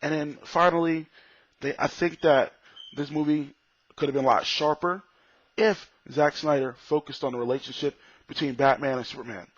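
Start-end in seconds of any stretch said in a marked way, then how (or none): none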